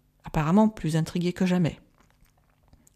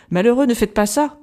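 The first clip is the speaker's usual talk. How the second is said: louder, urgent cry of alarm; 4 semitones higher, 7 dB louder than usual. Acted. Van Helsing stopping Arthur's kiss, alarmed cry. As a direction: shout him back from the bed. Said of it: "s'écria-t-il".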